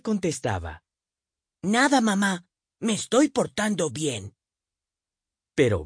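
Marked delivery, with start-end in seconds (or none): 1.6-4.3 put-on high, innocent little boy; sweet-talking kid reassuring his mum; young boy voice. A narrator's reading. Narrator voices young Billy.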